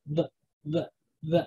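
V as a short, soft "vuh"; V said as bare "vuh", not the letter name; V sound. Shown as a demonstration. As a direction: sound out V as "vuh", one at a time. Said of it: "the V sound".

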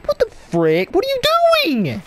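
sudden high what the frick; indignant gamer outburst; outraged surprise. Real, not acted. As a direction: caught off guard and annoyed. Gamer yelling at being killed.

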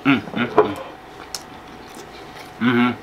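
humming with closed lips; savoring food while humming; mouth full, chewing. Real, not acted. Seen 5: Bit food just before; lips closed, cheeks working through the hums.

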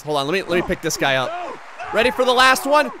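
ordinary talk, nothing marked

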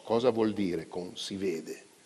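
plain reading voice

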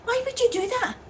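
high-pitched incredulous question; appalled disbelief. Acted. Driver hears passenger lied to mum, appalled. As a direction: pitch it right up, aghast.

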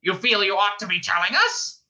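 high, furious bird squawk; avian fury squawk. A narrator's reading. Ford as penguin demanding facts, furious squawk. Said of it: "squawked Ford in avian fury".

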